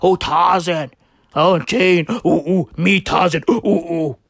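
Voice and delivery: lightly hammed-up jungle-man Tarzan with ape hoots; mock Tarzan. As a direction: grunt it out as a caricature Tarzan. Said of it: "talking about Tarzan".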